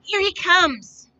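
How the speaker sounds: a man in the crowd shouting the news. A narrator's reading. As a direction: call out to the crowd slowly. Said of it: "he shouted".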